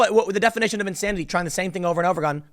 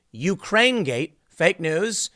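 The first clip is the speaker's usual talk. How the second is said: ordinary talk, nothing marked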